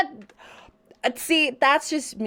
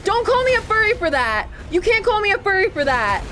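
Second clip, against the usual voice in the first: high-pitched, whiny denial; playfully outraged, pitch up high; indignant protest; 7 semitones higher than usual. Real, not acted. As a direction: protest the teasing in a raised pitch. Indignant denial of chat's teasing.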